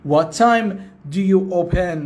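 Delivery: each word drawn out slowly; very slow model sentence. Shown as a demonstration. Teacher repeating the question very slowly for learners.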